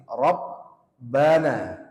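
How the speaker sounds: very slow Rabbana holding the double b; hold the shadda. Shown as a demonstration. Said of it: "Rabbana ini tahan di sini".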